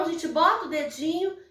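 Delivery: ordinary talk, nothing marked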